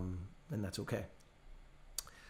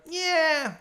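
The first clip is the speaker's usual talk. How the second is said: yeah pitched high and drawn down; a hammed-up sarcastic Yeah falling from high; mock sellers' yeah; 14 semitones higher, 3 dB louder than usual. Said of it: "they're gonna be like".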